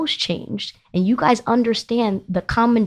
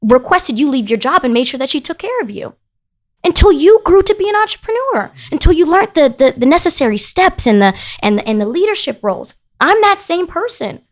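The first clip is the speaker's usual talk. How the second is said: retold angry argument; 7 semitones higher than usual. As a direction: replay the fight with raised, hurt voice. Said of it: "talking full-blown screaming match".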